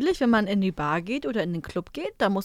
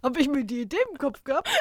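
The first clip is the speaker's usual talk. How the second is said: laughing mock quote; 3 semitones higher, faster than usual. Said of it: "Das war kein Satz".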